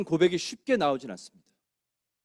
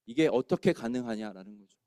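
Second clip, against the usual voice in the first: ordinary talk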